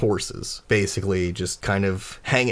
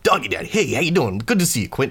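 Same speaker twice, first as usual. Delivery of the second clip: high, eager greeter voice; Doggy Daddy; 5 semitones higher, faster than usual. Acted. Ratty dude with an armful greets Quint, high-pitched. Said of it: "and he's like".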